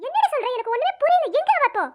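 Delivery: high, loud shocked questions; alarmed disbelief. Acted. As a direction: demand it, flustered and upset. Teacher shocked at injury.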